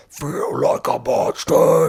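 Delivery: loud, slow, drawn-out monster growl. Retold or imitated. Imitating the song's monster voice coming in, loud growl. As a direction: roar it as a mock monster. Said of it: "the monster voice comes in".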